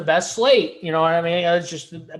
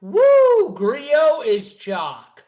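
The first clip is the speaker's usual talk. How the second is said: high, loud mock whoop, then slow; imitating co-host's whoop; 8 semitones higher, 5 dB louder, much slower than usual. Co-host suggests ending show on this, mimicking whoop. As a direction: whoop it, then draw out the words. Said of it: "we end it on Big T going".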